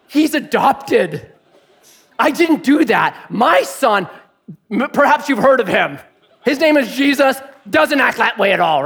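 hammed-up emphatic protest; mock disowning. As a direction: announce the disclaimer to onlookers. Imagines God disowning him publicly.

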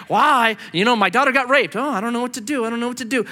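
mock high-pitched helplessness; helpless passive guy. Passive father fretting over his daughter, mock helpless. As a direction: lightly play a man who won't act. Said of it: "stops being the passive guy".

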